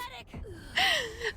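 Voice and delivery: very high breathy laugh; high squeaky laugh. Real, not acted. Laughing at the rude message joke, very high. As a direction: cackle up high after the joke.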